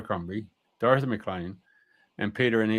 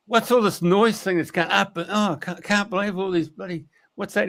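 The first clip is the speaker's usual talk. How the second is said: sprite voice; 9 semitones higher than usual. Retold or imitated. Sprite voiced in retold story.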